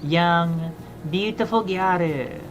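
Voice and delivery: ordinary talk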